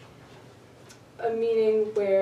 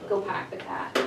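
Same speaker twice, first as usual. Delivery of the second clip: Boston accent; 3 dB quieter than usual. Shown as a demonstration. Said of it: "It's just the way it's said".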